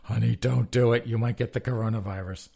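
loud, hammed-up plea to honey; mock husband begging his wife, pleading loudly. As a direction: beg her not to, over the top. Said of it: "tell Becky Falwell".

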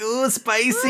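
jellyfish voiced. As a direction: voice the jellyfish high and silly. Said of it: "It was like".

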